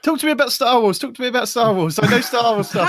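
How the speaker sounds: high, loud, worked-up challenge; indignant protest. Real, not acted. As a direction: fire back loudly, demanding to be tested. Mock quarrel over lost nerd cred.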